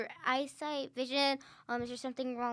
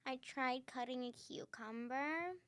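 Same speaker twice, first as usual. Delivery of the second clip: creaky vocal fry, softer; 10 dB quieter than usual. Pretend. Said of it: "Kinda sounds like Doctor Evil".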